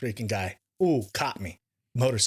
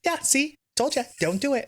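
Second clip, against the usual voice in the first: high, gloating I-told-you-so; wife gloating that she was right; teasing wife; 13 semitones higher than usual. Retold or imitated. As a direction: play the wife rubbing it in, pitched up. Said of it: "my wife's like".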